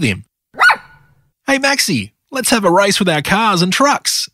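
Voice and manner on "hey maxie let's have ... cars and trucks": high-pitched, eager invitation to race; excited exclamation. A narrator's reading. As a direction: exclaim it brightly to a friend, pitched up. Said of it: "exclaimed Rocky".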